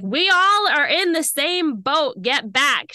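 irritated woman hammed up; imitating a snap. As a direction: play back the rude passenger's bark, slowed. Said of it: "snapped at me".

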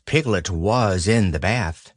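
usual speaking voice

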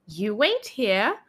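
ordinary talk, nothing marked

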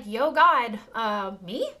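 chatty, stunned Moses; casual Moses. Retold or imitated. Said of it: "He's like".

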